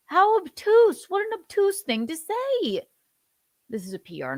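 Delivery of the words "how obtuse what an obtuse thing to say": high, indignant sneer; scornful. Real, not acted. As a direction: drag out how obtuse with scorn. Mocking the singer's remark.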